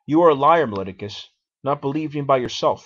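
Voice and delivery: loud, indignant outburst at the accuser; forceful accusation. Acted. Socrates calls Meletus a liar, loud and indignant. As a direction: throw the charge straight at him.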